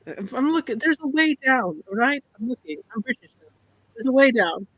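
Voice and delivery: badly faked Irish accent. Guide assures the party of a path, bad Irish accent. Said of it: "my bad accent".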